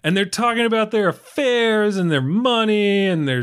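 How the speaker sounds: ordinary talk, nothing marked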